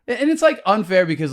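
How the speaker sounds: ordinary talk, nothing marked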